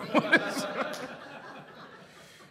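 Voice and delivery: high airy laughing; real amused laugh; breathy laugh. Real, not acted. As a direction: laugh at the memory. Laughing at own story.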